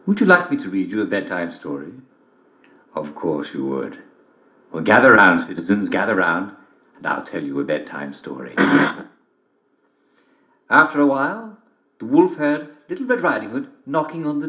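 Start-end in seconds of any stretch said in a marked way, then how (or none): 0.1-8.5 genial host inviting listeners; host welcoming listeners to bedtime story; radio storyteller intro. Acted. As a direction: gather the listeners round for a story.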